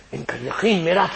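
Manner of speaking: ordinary talk, nothing marked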